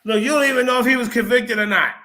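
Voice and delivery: loud, rising outrage; angry. Real, not acted. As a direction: push back hard and loud. Heated argument over hearsay.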